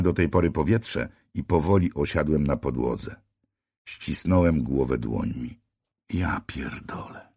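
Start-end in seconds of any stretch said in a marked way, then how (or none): 6.1-7.3 slow whispered exhausted curse. A narrator's reading. Narrator alone on the floor, slow whispered curse. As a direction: breathe out the swear, spent.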